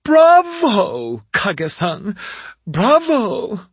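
high, loud sneering applause; mock him with bright theatrical praise; mocking witch. A narrator's reading. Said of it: "slow, mocking clapping".

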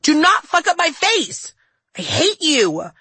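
high, slow, emphatic scream at a sister; furious yell. Acted. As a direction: shout every word in a rage. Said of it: "I yelled".

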